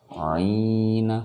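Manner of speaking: "aina" said as 'iina with i drawn out; long vowel. Shown as a demonstration. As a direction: hold the opening of "aina" as a long ii. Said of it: "baca panjang dan ini". ("aina" dibaca 'iina, dipanjangkan)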